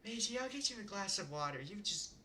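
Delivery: testing out a gentle mother voice; first try at the mom voice. Shown as a demonstration. Reader practising the mom's line before reading it. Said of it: "trying to perfect this voice".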